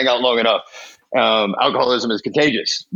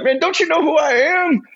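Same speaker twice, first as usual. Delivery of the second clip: put-on high, offended challenge to old man laughing; indignant disbelief. Young addict to laughing old-timer, indignant. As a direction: pitch it way up, who do you think I am. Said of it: "I'm like".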